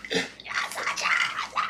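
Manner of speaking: soft whisper to the dog; whispered praise. Real, not acted. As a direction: breathe it to him.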